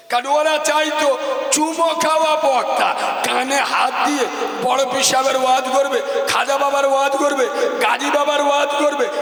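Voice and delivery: rapid preaching shout; fiery preaching. Acted. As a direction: rattle it off to the crowd. Preaching at full force.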